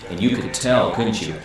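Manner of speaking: normal talking voice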